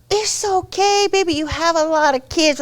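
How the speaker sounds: high-pitched, soothing mother-in-law voice; imitated doting mother declining politely. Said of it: "she said".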